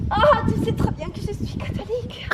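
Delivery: high, loud coquettish voice; coy protest. Retold or imitated. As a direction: protest playfully to the lover. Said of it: "je lui ai dit".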